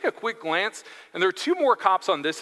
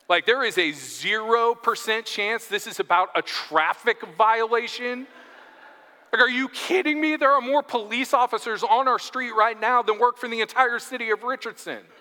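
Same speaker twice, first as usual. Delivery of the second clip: higher-pitched, protesting disbelief; mock incredulity; 5 semitones higher than usual. Neighbour disputing the police officer's explanation, mock incredulous. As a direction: build into an incredulous comic protest.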